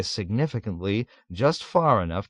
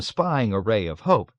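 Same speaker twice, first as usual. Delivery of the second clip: plain reading voice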